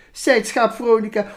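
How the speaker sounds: put-on lively, sing-song reading of a children's poem. Reading the Veronica poem aloud for the interviewer, animated. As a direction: read it out like a storyteller to kids.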